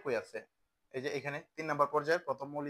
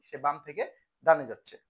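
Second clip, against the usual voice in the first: emphatic drill; 8 dB louder than usual. Shown as a demonstration. Teacher drilling answer loudly.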